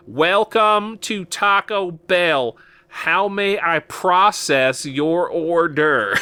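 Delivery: imitating a fast-food worker milking every word; drive-thru guy hamming it up, slow and lofty; hammed-up drive-thru greeting. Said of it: "he was very much hamming it up".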